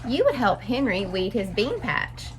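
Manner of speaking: ordinary delivery, nothing marked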